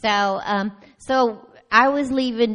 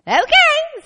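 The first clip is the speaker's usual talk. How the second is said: startled okay; 8 dB louder than usual. Retold or imitated. Sudden realization at police stop.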